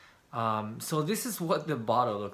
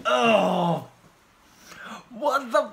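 loud, high startled cry at the taste; shocked outburst; 11 semitones higher, 9 dB louder, much slower than usual. Real, not acted. Tasting the takuan cola for viewers, a shocked yelp. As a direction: yelp as the awful flavor hits. First sip of pickle soda.

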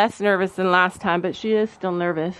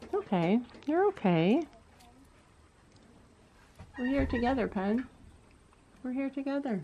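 soft soothing; 3 semitones higher, 7 dB quieter than usual. Real, not acted. Soothing a panicking horse.

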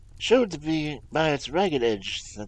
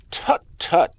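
Tut, tut sharply louder and higher; impatient cry; 6 semitones higher, 12 dB louder than usual. A narrator's reading. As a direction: scold him out of his gloom. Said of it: "cried Sherlock Holmes".

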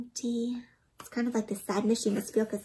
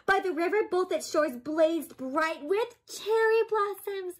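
put-on higher, bright read-aloud for children, slowing on the blossoms; excited storybook narration; 8 semitones higher than usual. A narrator's reading. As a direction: paint the spring festival with wonder. Excited narration of spring festival.

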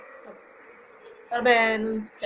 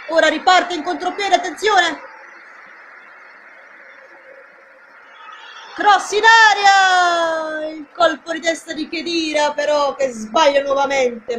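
breathless italian goal commentary; 5 semitones higher, slower than usual. Acted. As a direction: scream the chance as it comes. Counterattack, cross, header.

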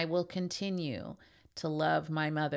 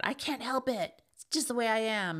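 mocking mom; 5 semitones higher than usual. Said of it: "they'll tell you that".

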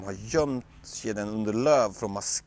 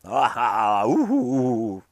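usual speaking voice

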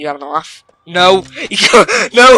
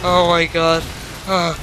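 usual speaking voice

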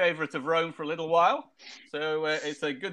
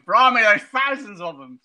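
mock soldier's alarm; 6 semitones higher, 7 dB louder than usual. Quoting famous film line.

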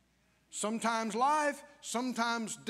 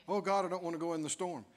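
fast mock whine; 6 semitones lower, 3 dB quieter, faster than usual. Quick mock whine repeated.

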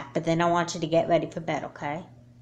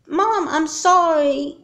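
high, slow, loud pleading; desperate apology; 10 semitones higher, 7 dB louder, much slower than usual. Acted. Daughter begging mom in a nightmare, panicked. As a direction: beg forgiveness in a panic.

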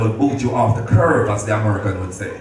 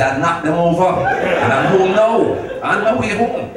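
higher and laughing, slowing on "home now"; laughing triumph; 6 semitones higher than usual. Retold or imitated. Calling his wife after winning big, laughing. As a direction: gloat through laughter about the win.

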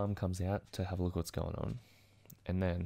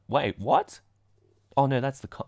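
much louder sudden startle; real surprise; 4 semitones higher, 11 dB louder than usual. Real, not acted. Vlogger catches his own date mistake, loud and startled. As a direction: blurt it out as you catch the mistake.

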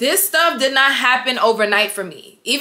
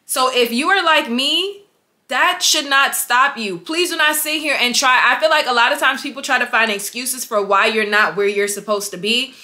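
normal talking voice

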